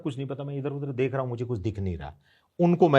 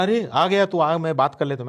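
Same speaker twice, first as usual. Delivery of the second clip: loud, much higher, hearty call of welcome; retold hearty greeting; 7 semitones higher, 6 dB louder than usual. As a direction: greet him like an old friend, loudly. Pandey greeting him, retold.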